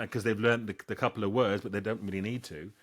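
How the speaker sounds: ordinary talk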